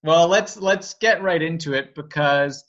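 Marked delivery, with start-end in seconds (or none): none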